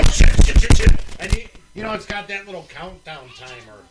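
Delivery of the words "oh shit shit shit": loud, high panicked swearing; mock panic. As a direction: act out a frantic scramble to cancel. Acting out cancelling emergency call.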